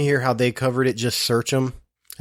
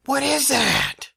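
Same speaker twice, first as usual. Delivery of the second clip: much higher, slow, mock-alarmed squeak; imitating wife; much slower than usual. Husband mimicking wife's alarm at the carpet, high mock squeal. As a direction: parody her startled question. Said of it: "I heard you go".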